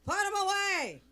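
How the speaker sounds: loud, high mock heckle; disgusted heckle yell. Construction workers heckling the older woman, mock yell. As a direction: yell it across a building site. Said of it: "Now they yell".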